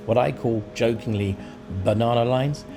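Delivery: ordinary talk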